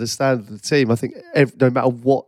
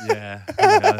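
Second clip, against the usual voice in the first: mimicked partner agreeing.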